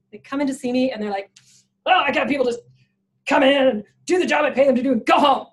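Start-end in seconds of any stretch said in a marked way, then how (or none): 1.8-5.5 loud mock-booming complaint; alpha male bluster. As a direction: bellow it like an exasperated alpha boss. Imitates alpha male clients complaining.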